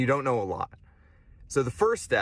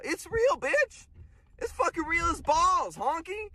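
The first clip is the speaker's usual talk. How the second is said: much higher, shrieking insistence; shrill outburst. Real, not acted. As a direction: shriek it at the doubters. Yelling at doubters.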